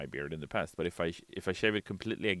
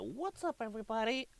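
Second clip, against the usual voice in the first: much higher, soft squeaky greeting; squeaky silly voice; 6 dB quieter than usual. Pretend. Streamer mocking his own greeting, squeaky and soft. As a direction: squeak it out like a cartoon.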